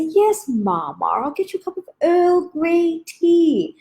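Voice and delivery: high, slow, sing-song reply; child answering grandma in a high, slow voice; dutiful granddaughter. Retold or imitated. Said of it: "And I'd say".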